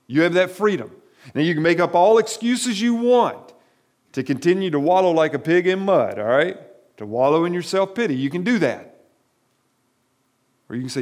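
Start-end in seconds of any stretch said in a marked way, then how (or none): 0.1-9.0 louder, insistent sermon voice; forceful preaching. Acted. Pastor challenging the congregation on self-pity, forceful preaching. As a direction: press the point hard on the listeners.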